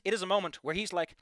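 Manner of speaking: ordinary delivery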